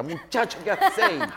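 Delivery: hammed-up shocked teacher; mock outraged professor. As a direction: professor bursts in appalled. Outraged professor voiced in story.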